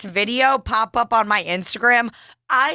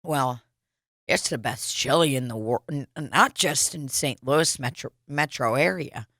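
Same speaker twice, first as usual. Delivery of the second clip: low, quiet mock Donald drone; a lower, quieter put-on Donald voice; imitating Donald; 7 semitones lower, 5 dB quieter than usual. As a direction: drop low and quiet, play the smug regular. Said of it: "He goes".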